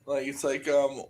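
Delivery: normal talking voice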